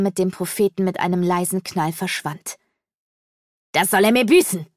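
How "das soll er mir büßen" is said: loud, vengeful outburst; enraged cry. A narrator's reading. Birdman as the magician vanishes, furious cry. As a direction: shout it in a rage. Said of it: "Nirga schrie wutentbrannt auf".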